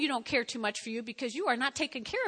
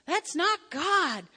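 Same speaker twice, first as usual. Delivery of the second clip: firm correction; 6 semitones higher, 4 dB louder, much slower than usual. Retold or imitated. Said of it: "and I was like".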